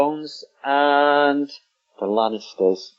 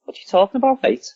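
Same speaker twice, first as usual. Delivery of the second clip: high-pitched Scouse demo; Scouse accent; 11 semitones higher, faster than usual. Shown as a demonstration. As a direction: put on a Liverpool Scouse accent.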